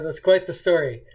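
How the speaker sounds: ordinary delivery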